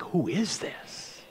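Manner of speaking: awed hush. Retold or imitated. Disciples in the boat asking who this is, in an awed hush. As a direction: breathe it out in stunned awe. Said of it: "they're basically like".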